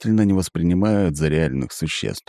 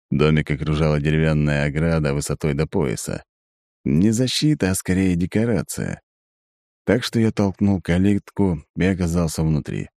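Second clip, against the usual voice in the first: ordinary delivery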